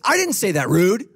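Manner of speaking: high, loud, hammed-up protest; mock defensive. Someone denying their bad tone, mock defensive. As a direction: protest your innocence in an offended squawk.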